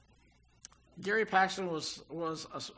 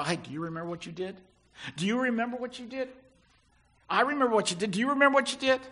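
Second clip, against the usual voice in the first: fast, rising nag, louder with each repeat; mock accusing nag; 4 semitones higher, 5 dB louder, much faster than usual. God pictured nagging the sinner over old sins, mock accusing. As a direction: needle him about his past, faster and louder. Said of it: "He doesn't hold it over you".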